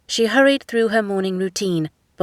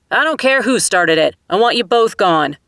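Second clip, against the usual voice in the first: firm raised voice; 3 semitones higher, 6 dB louder than usual. A narrator's reading. Guard orders fighters out.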